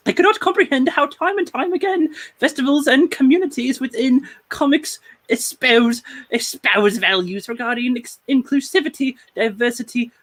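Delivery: high-pitched hammed-up British accent. Host quoting the cartoonist's protest, mock British voice, high. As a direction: read it in a put-on, high British voice. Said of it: "That is the British voice of the night".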